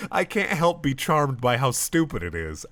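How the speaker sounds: ordinary talk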